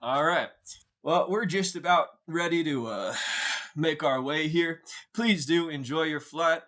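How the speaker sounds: high-pitched airline sign-off; high, courteous flight attendant patter; flight announcer. Acted. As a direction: announce departure in a put-on cabin voice.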